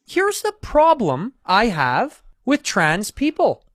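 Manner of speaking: slow, high, swooping lecture; high-pitched, laboured pronouncement; Jordan Peterson. Acted. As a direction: drag the words out, pitch climbing and falling. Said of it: "his Jordan Peterson impression".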